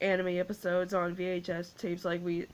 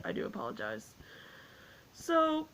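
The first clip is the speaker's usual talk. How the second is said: ordinary talk, nothing marked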